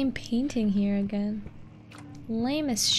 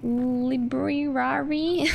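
drawn out; much slower than usual. Pretend. Drawn-out mock pronunciation.

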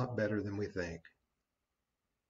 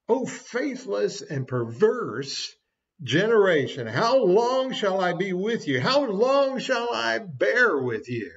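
Jesus's exasperated rebuke; 6 semitones higher, 6 dB louder, slower than usual. Acted. Jesus's rebuke quoted in sermon.

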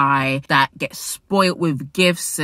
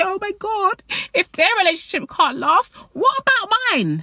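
much higher mock wail of a worried fan; panicked fan. Vlogger imitates fans after a breakup, high mock panic. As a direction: whine it like a heartbroken follower. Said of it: "And you say".

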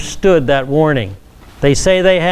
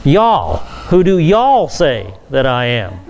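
"Y'all" set out alone, then stressed in "do y'all say"; emphatic plural you; 3 semitones lower than usual. Shown as a demonstration. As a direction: punch each "y'all" as the whole group. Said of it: "you is a second person plural".

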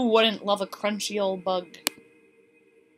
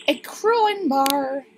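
ordinary talk

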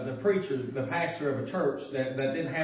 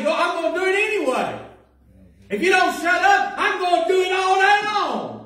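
much higher and louder, a defiant shout; 8 dB louder than usual. Pretend. Believer shouting defiance at the devil, loud and high. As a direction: yell it right back at the devil. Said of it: "You gotta say".

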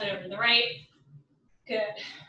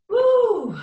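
loud, high whoop of excitement; excited cheer; 10 semitones higher, 8 dB louder than usual. Real, not acted. Cheer in workout class.

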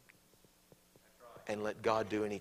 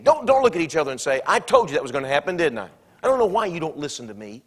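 fast, louder, higher I-told-you-so; a hammed-up know-it-all wife; mock nagging spouse; 5 semitones higher, 12 dB louder, faster than usual. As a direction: nag like a smug spouse. Acted nagging spouse, loud fast.

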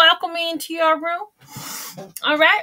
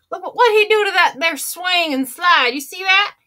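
ordinary delivery

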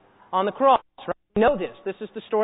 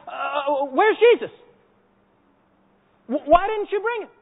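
high, frustrated demand; mock exasperation, pitched high; exasperated; 3 semitones higher, slower than usual. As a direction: act out priests tearing their hair out. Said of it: "pull out their hair proverbially and say".

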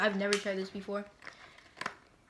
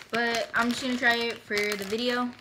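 ordinary talk, nothing marked

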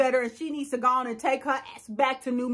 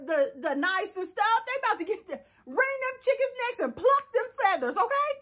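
normal talking voice

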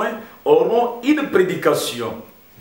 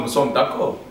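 ordinary delivery

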